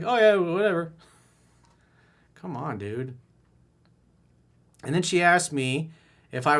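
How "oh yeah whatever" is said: higher, louder careless brush-off; mocking the guy who missed her hint; mock dismissive. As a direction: toss it off like a clueless guy. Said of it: "you're like".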